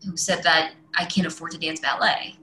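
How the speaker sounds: ordinary talk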